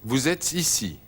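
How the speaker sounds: ordinary delivery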